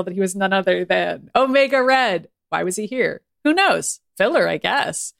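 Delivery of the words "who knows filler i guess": usual speaking voice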